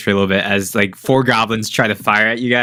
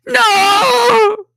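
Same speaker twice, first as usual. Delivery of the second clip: shrill, much higher, loud goblin shriek; a crowd of goblins yelling in despair; goblins crying no; 5 dB louder than usual. Acted. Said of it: "you hear the goblins go".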